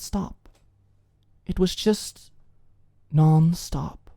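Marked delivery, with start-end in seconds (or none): none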